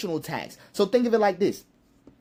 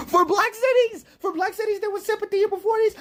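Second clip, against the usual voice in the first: much higher, incredulous repeat; mock disbelief; 11 semitones higher than usual. Real, not acted. Repeating a viewer's claim back, high and mocking.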